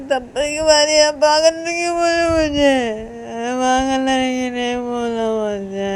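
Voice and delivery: acted tearful tantrum, fading at the end; crying. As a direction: sob out an angry dismissal. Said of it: "ऐसे बोल के रो रही थी".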